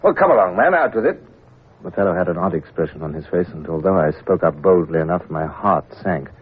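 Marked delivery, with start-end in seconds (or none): 0.0-1.2 impatient demand. Acted. Impatient captain presses the clerk.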